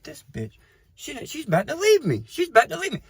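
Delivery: high, rising panic as mom drives off; panicked disbelief. Retold or imitated. Son panicking as his mom drives away, high frantic voice. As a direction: voice jumps up high in alarm.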